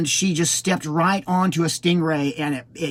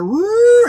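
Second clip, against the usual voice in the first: loud, high rising siren wail; mimicked siren, pitched way up and held; imitated siren; 8 dB louder than usual. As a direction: wail like an emergency siren pulling up. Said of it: "I heard a".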